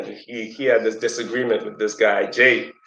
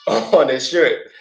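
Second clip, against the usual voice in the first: ordinary delivery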